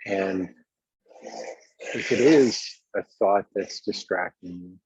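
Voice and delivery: ordinary talk, nothing marked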